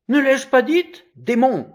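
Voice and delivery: high, loud outburst; high-pitched angry curse; outraged mother. A narrator's reading. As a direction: cry out in indignation. Mother protests, cursing the child.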